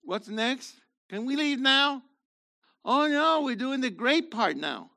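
hammed-up restless fidgeting; mock bored listener. Pastor mimics a restless listener, mock impatient whine. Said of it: "to go like".

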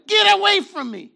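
high, loud furious order; angry shout. Retold or imitated. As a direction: drive everyone out of the room. Joseph sends everybody away.

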